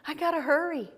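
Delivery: high, breathless-to-hurry servant act; flustered servant. Retold or imitated. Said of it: "Carolyn's acting like the servant".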